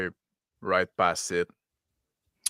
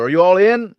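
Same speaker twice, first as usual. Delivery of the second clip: loud, higher call rising at the end; yell over the shoulder; 8 semitones higher, 11 dB louder than usual. Acted. Pilot checking the crew is aboard, yelling back. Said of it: "I yell over my shoulder".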